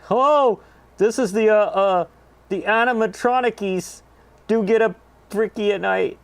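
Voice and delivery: high, loud mocking parody; squeaky parody of the line.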